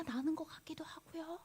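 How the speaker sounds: put-on secretive whisper to children. Sniffing, playing mystery for kids.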